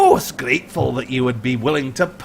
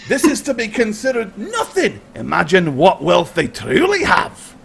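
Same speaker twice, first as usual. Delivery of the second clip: high gloating Highlander; 10 semitones higher than usual. Acted. Highlander gloats to Hinder over the pouch, high greedy voice. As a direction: savour the gold, gloating.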